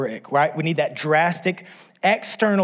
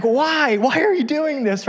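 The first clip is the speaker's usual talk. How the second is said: much higher, louder mock whine; panicked husband; 11 semitones higher, 5 dB louder, slower than usual. Husband pleading with his wife, comic alarm. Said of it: "And I'm like".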